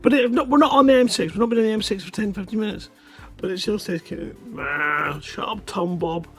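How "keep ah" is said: ordinary delivery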